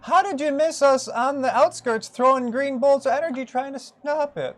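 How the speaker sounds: high-voiced old rabbit priest greeting survivors; old rabbit folk. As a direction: pitch way up for a doddery old rabbit.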